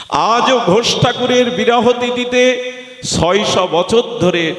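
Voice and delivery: very high, sustained declamation; ringing sermon delivery; impassioned preaching. Acted. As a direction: proclaim it high, holding the note. Preaches at full force.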